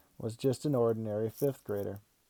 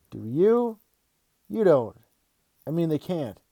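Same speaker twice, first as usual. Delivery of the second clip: high, loud incredulous questioning; stunned disbelief; 6 semitones higher, 7 dB louder than usual. Acted. Kid reacting to dad's news, in stunned disbelief. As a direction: blurt it out, not daring to believe it.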